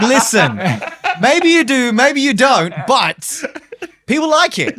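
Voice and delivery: high, loud laughing comeback; laughing retort. Retold or imitated. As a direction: laugh it off at mum. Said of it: "I'm like".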